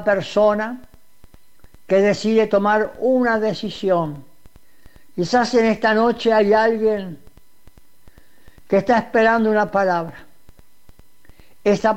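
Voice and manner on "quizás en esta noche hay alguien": ordinary talk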